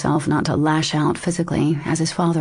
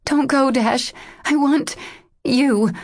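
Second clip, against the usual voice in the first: pleading; 9 semitones higher, slower than usual. A narrator's reading. Begging him not to leave.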